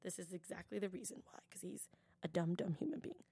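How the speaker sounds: hushed whisper; conspiratorial whisper; whispered aside. Real, not acted. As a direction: whisper the insult. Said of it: "whispered insult aside".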